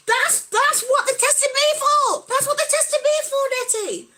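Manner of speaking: high, loud, slowed exclamation of recognition; excited recognition. Real, not acted. Vlogger excitedly telling a viewer she had the same test. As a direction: exclaim it as the penny drops.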